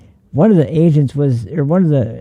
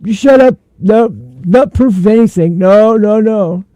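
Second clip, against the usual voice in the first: higher, louder whiny mock of a pundit; shrill-free mock rant, higher and louder; mocking Roland; 7 semitones higher, 4 dB louder than usual. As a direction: ham up a pundit snapping shut up. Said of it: "Roland over here is saying".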